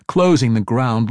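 plain reading voice

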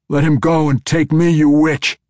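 loud, high, furious plea; shouted. A narrator's reading. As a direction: shout defiance at the captor to save a friend. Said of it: "Entreri shouted".

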